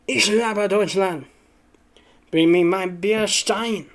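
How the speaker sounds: slow, hammed-up German accent; mock German, drawn out; German accent practice. Shown as a demonstration. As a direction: test out a stagey German accent, slowly. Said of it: "I should know what it sounds like".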